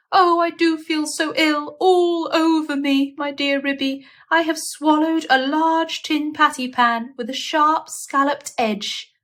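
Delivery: high, plaintive invalid voice; complaining patient. Acted. Duchess fretting to Ruby about her illness, high plaintive. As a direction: fuss like a hypochondriac.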